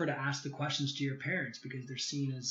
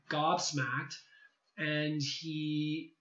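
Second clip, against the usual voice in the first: ordinary talk, nothing marked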